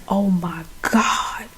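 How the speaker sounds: breathless whispered realisation, slow; shocked whisper. Retold or imitated. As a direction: whisper it as the truth dawns on you. He suspects his wife.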